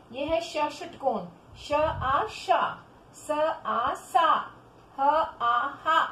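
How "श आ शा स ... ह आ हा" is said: very slow syllables, each vowel drawn out; pronunciation drill. Shown as a demonstration. Teacher drilling children on the barakhadi, slow pronunciation demo. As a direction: chant out letter, vowel, then the joined syllable. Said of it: "पहले उच्चारण करो".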